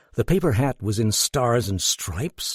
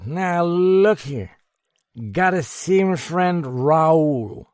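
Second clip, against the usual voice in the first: high, loud, slow slurred rambling; mollified drunk; 6 semitones higher, 5 dB louder than usual. A narrator's reading. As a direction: ramble sentimentally about a friend. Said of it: "admitted in a mollified tone".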